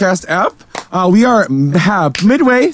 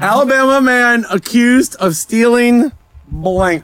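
headline read; 4 semitones higher, much slower than usual. Acted. Reading the game headline.

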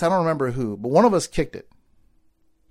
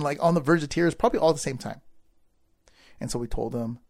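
ordinary delivery

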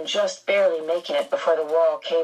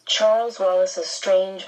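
ordinary delivery, nothing marked

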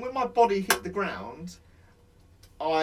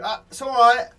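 mock reassurance; 6 semitones higher, 9 dB louder than usual. Mocking medics' reassurance.

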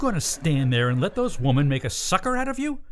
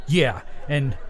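ordinary talk

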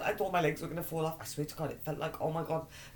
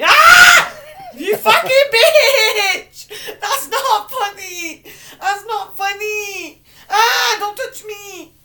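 very high, drawn-out shrieks; pained screaming; 13 semitones higher, 16 dB louder, much slower than usual. Real, not acted. Yelling at a friend who touched her sore legs, pained screaming. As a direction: scream each word out in long wails. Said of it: "you're just screaming about pain".

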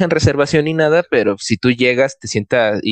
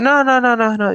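high refusal; 11 semitones higher than usual. Retold or imitated. Date refusing the Uber.

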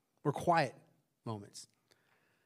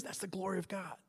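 hushed whisper; 2 semitones higher, 13 dB quieter than usual. Acted. Preacher revealing God's glory to the congregation, whispered. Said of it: "hushed reveal of the point".

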